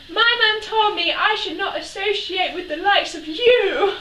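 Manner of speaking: loud prim shout; shouted back. Retold or imitated. Child shouting down to the neighbours, prim shout. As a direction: yell it back primly from the tree. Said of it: "I proceeded to shout back".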